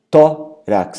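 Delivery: TÓ-rax, mais alto e forte no tó; sílaba tônica. Shown as a demonstration. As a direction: bata forte no tó. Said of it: "uma sílaba tó-, é, forte". (tórax com o tó mais alto e forte)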